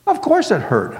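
high-pitched, irritated snap; snapped. A narrator's reading. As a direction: snap back in exasperation. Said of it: "I snapped".

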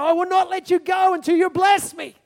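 loud and high, gripping on with all he has; mid-wrestle. Shown as a demonstration. Jacob wrestling God, refusing to let go, loud and high. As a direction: shout it like you are still holding on. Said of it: "put ourselves in that moment when there's a wrestle".

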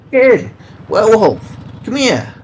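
loud high calls from an alley; calling out. Acted. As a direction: shout to get the kid's attention.

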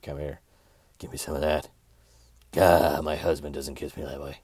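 a hint of a needy wife; lightly voiced lustful woman. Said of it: "I'm doing their sound effects".

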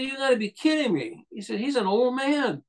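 high-pitched, incredulous retold reaction; a producer who can't believe his eyes; stunned disbelief. Producer realizing the teen voice is an old man, incredulous.

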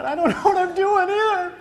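high nervous giggle of a scared rider; nervous laughing thought. Retold or imitated. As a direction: laugh it out in a high panicked squeak. Panicked thought on the bull.

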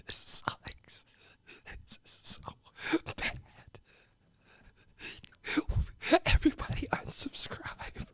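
soft, voiceless tearful whisper; real breakdown, barely getting words out. Real, not acted. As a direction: whimper it in broken pieces. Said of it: "you're getting me to cry".

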